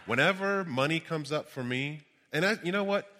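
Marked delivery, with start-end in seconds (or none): none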